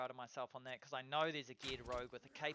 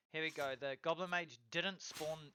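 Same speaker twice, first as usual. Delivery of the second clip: ordinary talk, nothing marked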